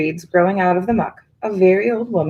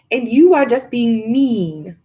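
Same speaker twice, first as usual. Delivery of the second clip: slow, louder reproach; a touch of a wounded scold; accusing witch; 5 semitones higher, 4 dB louder, slower than usual. A narrator's reading.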